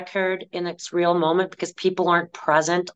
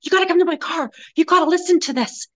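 excited urging; 10 semitones higher, 3 dB louder, faster than usual. Retold or imitated. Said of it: "she goes".

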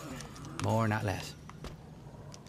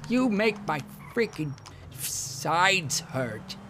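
higher, slow whiny friend; a squealing pal overreacting; Lester voice; 6 semitones higher, 4 dB louder, slower than usual. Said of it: "But I'll just reenact it".

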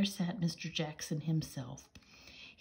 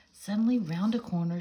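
plain reading voice

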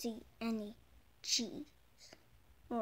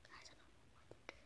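hushed worried whisper; whispering to yourself; 24 dB quieter, much faster than usual. Acted. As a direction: breathe it out under your breath.

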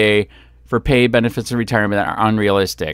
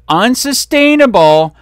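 outraged shout; 10 semitones higher, 5 dB louder than usual. Real, not acted. Angry rant about police pay.

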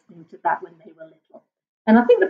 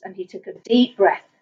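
ordinary delivery

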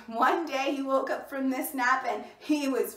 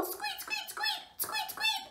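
tiny, very high squeaking; alarmed mouse squeaks; 6 dB quieter than usual. A narrator's reading. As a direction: squeak like a worried little mouse. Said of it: "Little Mouse came back".

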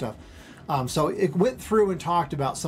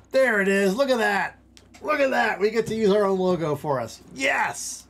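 excited; 6 semitones higher than usual. Real, not acted. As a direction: gush over the new logo. Thrilled at his custom logo.

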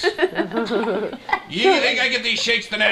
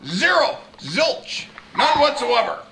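raised-pitch comic rant; mock outrage; 5 semitones higher than usual. Baby complains about brother rolling on the floor, mock-outraged. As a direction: punch each word with exasperated disbelief.

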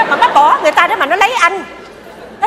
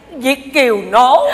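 mocking jab; 4 semitones lower, much slower than usual. Mother mocks bragging overseas Vietnamese.

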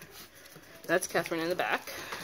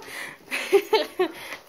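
breathy laugh; 12 semitones higher than usual. Real, not acted. Laughing at the biting puppies.

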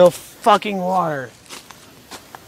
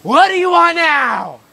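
exasperated; 11 semitones higher, 4 dB louder, slower than usual. Acted. Annoyed at the villain's return.

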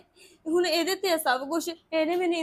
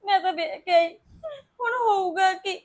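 tearful, choked voice; crying while asking what will happen; 3 semitones higher, 3 dB louder than usual. Acted. Woman weeping over police case.

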